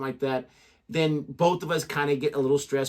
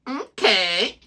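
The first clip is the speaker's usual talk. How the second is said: phone voice okay; 4 semitones higher than usual. Retold or imitated. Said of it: "people always do that".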